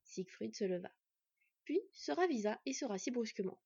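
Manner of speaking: plain reading voice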